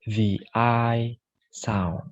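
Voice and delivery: slow, drawn-out I sound; the "I" diphthong said slowly for a class; I sound demo. Shown as a demonstration. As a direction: let the "I" glide from a to i slowly. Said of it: "This is".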